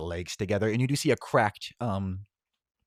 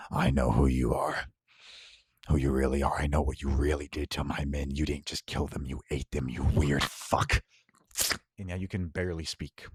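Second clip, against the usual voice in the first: low menacing threat; 6 semitones lower than usual. Acted. Villain threatening the broken hero, low and cold. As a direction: drop low and threaten him coldly.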